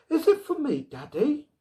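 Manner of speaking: put-on high, eager child's voice; a small girl asking her daddy; little girl voice. A narrator's reading. Little girl asks father.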